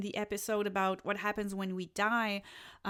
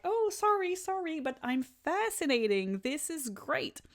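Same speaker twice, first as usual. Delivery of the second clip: excited gushing; 7 semitones higher than usual. Retold or imitated. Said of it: "and like".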